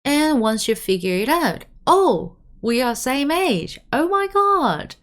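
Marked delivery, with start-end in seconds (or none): 1.9-5.0 high, thrilled mock surprise; acted delight, pitched way up; excited discovery. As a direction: gush at a new friend your own age. Acts out discovering same age.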